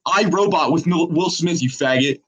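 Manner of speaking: mock them hard like a bully; aggressive taunt. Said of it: "That was aggressive".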